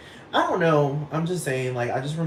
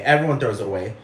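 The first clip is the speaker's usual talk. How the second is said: normal talking voice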